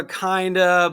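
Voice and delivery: usual speaking voice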